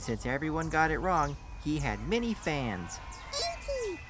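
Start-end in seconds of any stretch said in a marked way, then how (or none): 3.3-4.0 put-on a high, squeaky child's cry; a hint of an excited kid calling out; child's yell.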